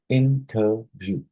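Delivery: "interview" slowly split as in-ter-view; syllable by syllable. Shown as a demonstration. Teacher showing a trisyllabic word, slow syllable-by-syllable "interview". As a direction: sound out "interview" in three separate beats. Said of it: "A trisyllabic word would be".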